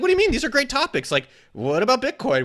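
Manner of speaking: hammed-up offended protest, high and loud; indignant disbelief. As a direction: squawk back like you can't believe it. Retold indignant protest to partner.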